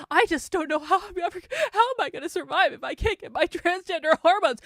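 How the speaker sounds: hammed-up imitation of a distraught man; mock whiny plea. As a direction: parody a panicked speaker, overdone and pitiful. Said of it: "This guy is being like".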